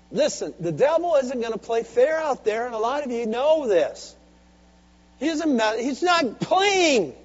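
loud, high preaching, slowing to a shout; fiery preaching. Acted. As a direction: warn the church hard. Preaching at full force.

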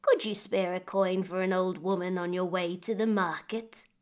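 put-on a frail old woman asking for a coin; lightly voiced old woman begging; old beggar woman. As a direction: voice an old woman, a little creaky. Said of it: "replied the old woman".

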